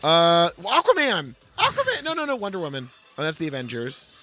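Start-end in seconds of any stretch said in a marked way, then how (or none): none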